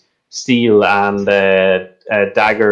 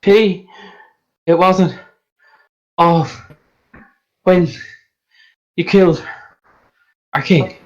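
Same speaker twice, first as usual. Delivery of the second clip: higher voice, long breaks between words; winded accusation; 5 semitones higher than usual. Acted. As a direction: push out each word separately, short of breath. Choked guard accuses, halting.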